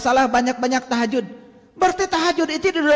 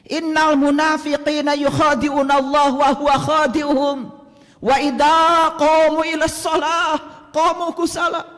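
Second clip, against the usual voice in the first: Quran recitation. Acted. Recites Quran verse in sermon.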